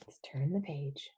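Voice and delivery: soft unvoiced whisper; quiet storytime whisper. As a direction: whisper it as a secret to the child. Whispering to child.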